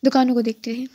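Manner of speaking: usual speaking voice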